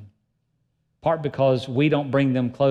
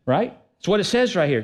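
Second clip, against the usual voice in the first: normal talking voice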